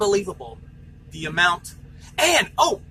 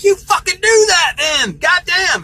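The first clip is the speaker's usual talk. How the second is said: higher, louder, slower yell; retold furious shout; 10 semitones higher, 8 dB louder, slower than usual. To the safety guy threatening the DM, furious shout. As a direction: shout it back in defiance.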